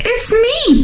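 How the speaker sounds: very high, squeaky little goat; high-pitched baby animal voice; squealing baby goat. A narrator's reading. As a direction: squeal it like a tiny kid goat. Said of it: "Baby Gruff squealed".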